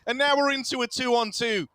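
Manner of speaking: high, animated esports commentary; excited play-by-play. Real, not acted. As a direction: narrate the live turn with rising excitement. Live play-by-play.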